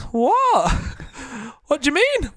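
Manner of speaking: put-on high, startled old lady asking; grandma confused. Grandma startled that everyone heard her, high-pitched. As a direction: squeak it out in high bewilderment. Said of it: "she was like".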